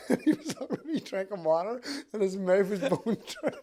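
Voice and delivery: high, loud, through real laughter; helpless laughing delivery; laughing while telling. Real, not acted. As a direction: struggle through the laughs to tell it. Laughing through his funny wedding story.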